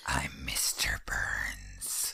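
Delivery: very slow, drawn-out whisper; ghostly whisper. Retold or imitated. Teller voicing the ghost heard in the Oval Room, ghostly whisper. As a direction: whisper it like a ghost, stretching each word. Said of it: "he heard a whisper which said".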